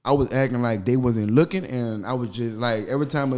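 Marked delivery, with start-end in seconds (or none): none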